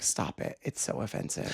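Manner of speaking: whispered imitation of a disapproving aside; very soft, near-whispered reproach; hushed scolding. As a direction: scold under your breath, as if embarrassed.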